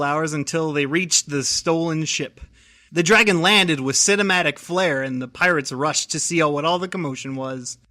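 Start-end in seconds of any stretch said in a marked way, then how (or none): none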